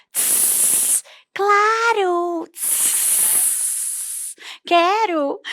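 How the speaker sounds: a snake answering with sharp hisses; hissing snake. Shown as a demonstration. Snake answers the dog's invitation with hisses. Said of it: "diálogo entre um cão e uma cobra".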